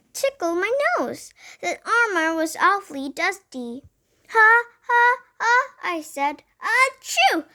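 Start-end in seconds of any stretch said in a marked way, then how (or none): 4.3-5.6 high, loud pre-sneeze gasps; acted build-up to a sneeze. A narrator's reading. Girl in dusty armor room, about to sneeze. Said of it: "My sneeze knocked me". 6.6-7.5 high-pitched spoken achoo; acted sneeze. A narrator's reading. Girl tickled by armor dust, acted sneeze. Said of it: "My sneeze".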